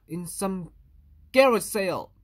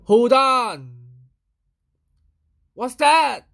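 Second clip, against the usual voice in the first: loud, higher alarm; alarmed surprise; 5 semitones higher, 10 dB louder, much slower than usual. Acted. Louis startled by a mess on his page. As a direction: stop short, startled.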